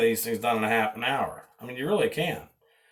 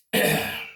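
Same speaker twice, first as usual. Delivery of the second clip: throat clear. Real, not acted. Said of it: "I got heartburn".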